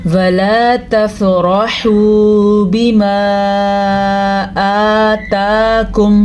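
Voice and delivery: slow, drawn-out tajweed chant; slow melodic Quran recitation; Quranic recitation. Shown as a demonstration. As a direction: recite with long, measured vowels. Recites verse.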